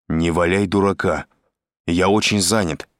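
ordinary delivery

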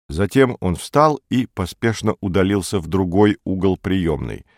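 ordinary delivery

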